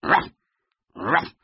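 two short gruff woofs; dog bark. Acted. Biggest husky to Floppy, barking. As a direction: bark "ruff, ruff" like a big dog. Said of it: "barked at Floppy".

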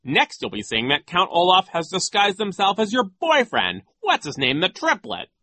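high, loud, pompous teasing; sneering high-voiced official; Nero. A narrator's reading. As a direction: taunt the children with a silly joke. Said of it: "making idiotic jokes".

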